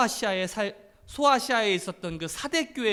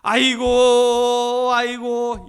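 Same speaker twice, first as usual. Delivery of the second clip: long drawn-out mock funeral wail; imitated keening of a mourning wife; acted loud wailing; 4 semitones higher, 11 dB louder, much slower than usual. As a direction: wail it out loud, stretching the cry. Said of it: "대성통곡을 하는 거예요".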